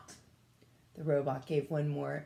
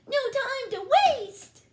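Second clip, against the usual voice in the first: very high, louder order; squeaky brother hurrying them in; urgent alien; 5 dB louder than usual. A narrator's reading. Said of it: "cried Nick Lewitzka".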